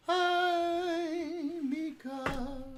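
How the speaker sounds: high, sing-song greeting to the dog; high pet voice. Real, not acted.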